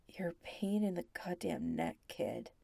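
hushed whisper, fond scolding; softly. Acted. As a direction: scold him gently under breath. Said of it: "Zach says softly".

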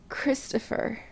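whispered, breathy gasp of the name; breathless gasp. A narrator's reading. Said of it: "Adam gasped".

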